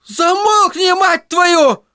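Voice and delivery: высокий мальчишеский визг; сорвись в визг от бешенства; взвизгнул. Acted. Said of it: "взвизгнул Тедди". (Тедди взвизгивает от бешенства)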